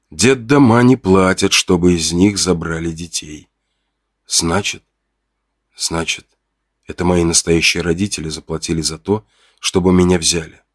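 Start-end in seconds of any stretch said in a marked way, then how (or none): 4.3-4.8 softer, rising unfinished word; hesitant rising. Acted. The narrator begins to grasp the truth, hesitant and soft. Thinking aloud. 5.7-6.3 much softer, falling away; fading whisper-soft. Acted. The narrator, stunned, repeats the word as his voice fades. Thinking aloud.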